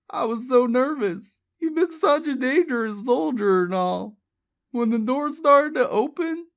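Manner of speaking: high, anguished pleading; distraught confession. Acted. Elizabeth confesses with tears, high and distraught. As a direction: confess desperately until cut off.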